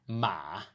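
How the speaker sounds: MA dipping low from mid pitch; third tone. Shown as a demonstration. Teacher giving the word for horse, MA in a dipping third tone. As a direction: let MA sink low, then lift slightly. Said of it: "The pitch starts mid".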